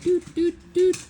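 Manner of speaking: high little "doot, doot, doot" knocks; knocking sound. Acted. Teacher miming a knock on the mind's door, high doots. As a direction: imitate knocking with short sung-out doots. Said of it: "you knock on the door".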